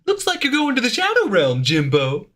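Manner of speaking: high, goofy cartoon dad voice; Hugh Neutron impression. Ham up a dopey cartoon dad. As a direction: streamer quoting the cartoon dad in his voice, high goofy mimicry. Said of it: "Hugh Neutron".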